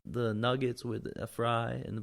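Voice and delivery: a drive-thru order, slow and put-on, hammed up; playfully ordering like a customer, the pitch jumping around; mock ordering voice.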